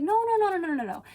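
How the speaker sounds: high, rapid string of rejections; mock director's refusal. Director rejecting a tennis stroke, exasperated mock refusal.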